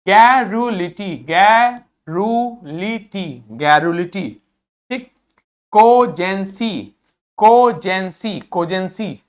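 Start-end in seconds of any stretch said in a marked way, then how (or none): 0.1-3.4 गैरुलिटी stretched out slowly; very slow model pronunciation. Shown as a demonstration. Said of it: "जहां का बोलेंगे वहां के लिखते हैं". 5.7-8.4 कोजेंसी said slowly; slow model pronunciation. Shown as a demonstration. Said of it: "जहां का बोलेंगे".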